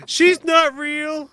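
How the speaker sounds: high, loud, drawn-out mock whine; slow, hammed-up kid protest. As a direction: imitate a child objecting. Kids protesting the parent's threat.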